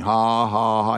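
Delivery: deliberate, imitated "ha, ha, ha"; Brother Hagin's defiant laugh. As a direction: mimic someone laughing at the devil. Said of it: "He laughed another twenty minutes".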